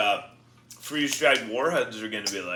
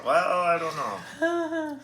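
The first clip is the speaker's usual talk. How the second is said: laughing; 8 semitones higher, much slower than usual. Real, not acted. Laughs through sour candy.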